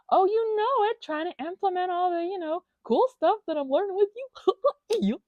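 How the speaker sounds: high, loud, overly bubbly reply; hammed-up cheerful self. Her own acted reply in therapy.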